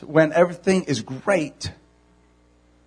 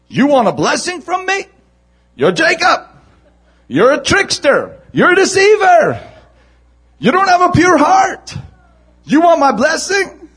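mock accusation; 8 semitones higher than usual. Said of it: "pointed his finger at him and said".

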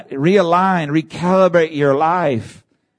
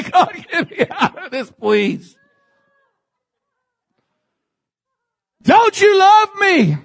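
a hint of a high, half-laughing plea to God; pleading prayer. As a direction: mock-desperate cry heavenward. Voicing a desperate prayer.

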